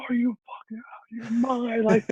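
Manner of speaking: high mock outburst from dad; father scolding his son for refusing, mock high outburst; imitating angry dad. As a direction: play the dad, stunned and mocking. Dad yelling at him.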